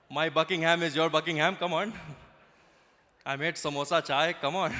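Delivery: mock Queen hosting in an Indian accent; hammed-up Indian-accented invitation. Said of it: "never seen Queen Elizabeth go".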